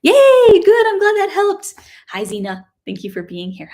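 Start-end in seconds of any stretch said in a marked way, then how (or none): 0.0-1.8 high, loud happy cheer; excited cheer. Real, not acted. Teacher cheers a viewer whose chord now works. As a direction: cheer them on.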